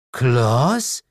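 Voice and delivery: high, rising question; puzzled demon mother. Acted. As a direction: ask the name in disbelief.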